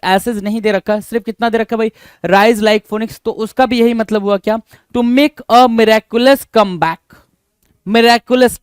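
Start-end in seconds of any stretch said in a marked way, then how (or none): 5.0-8.6 each word drawn out, louder; slow dictation. Shown as a demonstration. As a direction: spell it out slowly for note-taking. Teacher dictates meaning slowly.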